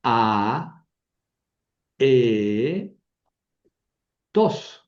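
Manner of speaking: A, e, tos split into three separate syllables with long pauses. Shown as a demonstration. Teacher splits aetos into syllables for students, slow and separate. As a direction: pull aetos apart, one syllable at a time. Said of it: "Son dos sílabas diferentes".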